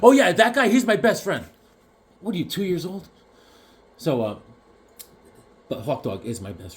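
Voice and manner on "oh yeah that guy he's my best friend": high, overeager mock voice; mocking gushing type. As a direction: parody a guy gushing about his buddy. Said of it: "I hate those guys that are talking like".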